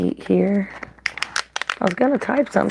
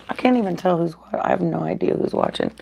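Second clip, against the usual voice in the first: usual speaking voice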